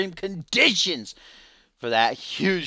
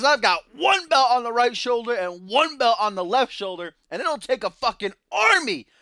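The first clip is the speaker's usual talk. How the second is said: a lightly voiced cocky wrestler, pitched higher at first; Duo Maxwell voice; 8 semitones higher than usual. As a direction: read it like a brash wrestler's tweet, half in character. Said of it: "sounds like something Duo would say".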